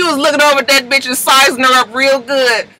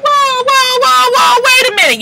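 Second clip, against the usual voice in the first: shocked whoa; 12 semitones higher than usual. Retold or imitated. The whole panel reacting to gossip, shocked. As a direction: stop everyone in alarm. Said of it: "everybody was just like".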